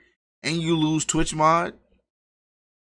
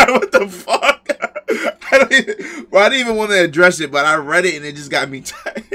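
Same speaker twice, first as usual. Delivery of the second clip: loud, high words broken by laughter; laughing hard; 5 semitones higher, 8 dB louder than usual. Real, not acted. Streamer to viewers about a message that riled him, laughing hard. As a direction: crack up while trying to explain yourself.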